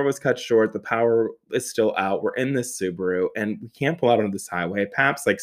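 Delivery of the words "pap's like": ordinary talk, nothing marked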